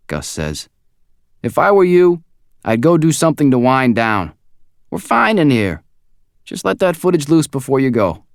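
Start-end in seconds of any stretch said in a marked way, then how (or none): 1.4-8.2 higher, louder, fast casual coworker; chatty and easygoing; friendly coworker. A narrator's reading. Gus voiced by narrator.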